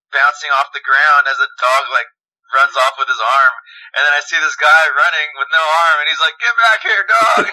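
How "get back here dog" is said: high-pitched mock yell after a runaway dog; comic imitation of a man calling his dog; retold chasing yell. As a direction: call out high, imitating a frantic dog owner.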